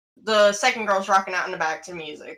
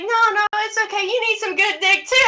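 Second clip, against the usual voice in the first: much higher mock insisting voice; pushy drunk; 11 semitones higher, 4 dB louder than usual. Drunk passenger pressing the driver, high-pitched mock insistence. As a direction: imitate a drunk who won't take no.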